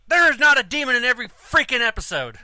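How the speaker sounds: mock angry telling-off. Wife confronting a ghost-hunting host, indignant mock yell. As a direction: scold him like a fed-up fan. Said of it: "slap him and say".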